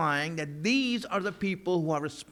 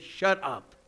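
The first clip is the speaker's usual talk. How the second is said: stern command; 3 semitones higher, 4 dB louder than usual. Retold or imitated. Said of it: "Just said".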